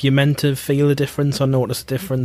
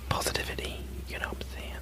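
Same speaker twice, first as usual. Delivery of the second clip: whispering; 15 dB quieter, faster than usual. Acted. Jokey whisper to co-host.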